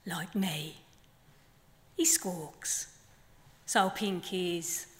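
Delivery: measured poem delivery with long pauses; poem reading. Acted. Poet reading her flamingo poem to the festival audience, measured. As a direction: let each line land, unhurried.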